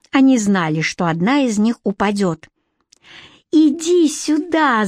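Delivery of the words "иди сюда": slow, high-pitched angry call; shout. A narrator's reading. Pursuer calls fleeing rival back, high shout. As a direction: draw out the command as a mild shout. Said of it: "закричала Златовласка".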